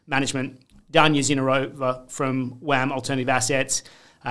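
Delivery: normal talking voice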